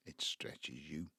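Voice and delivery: slow, breathy and low; weary whisper. Acted. Father explaining money troubles to his children, worn-out whisper. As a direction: let the weight press it down.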